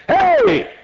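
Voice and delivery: high ringing call to the gates. Acted. Voice at heaven calling the gates, high ringing shout. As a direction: call it out like a herald. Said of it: "and the voice says".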